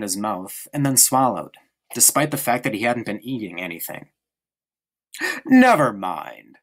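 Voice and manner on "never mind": slow, cheerful dismissal, a bit louder; cheerfully. A narrator's reading. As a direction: brush it aside with easy good cheer. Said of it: "said Father cheerfully".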